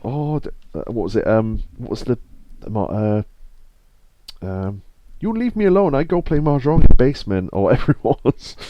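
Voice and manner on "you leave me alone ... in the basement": high, loud mock Chinese accent. Streamer quotes a half-remembered line, loud mock Chinese accent. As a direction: imitate a comic Chinese accent, pitched up and loud. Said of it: "trying to do the Chinese accent they do in Gumtown".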